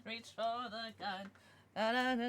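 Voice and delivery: ordinary talk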